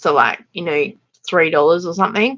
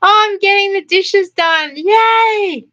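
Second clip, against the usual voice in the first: much higher, chirpy sing-song voice; mock cheery streamer; 12 semitones higher, 3 dB louder, slower than usual. Mocking someone who streams while washing dishes, high and chirpy. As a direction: squeak it out like a fake-happy influencer.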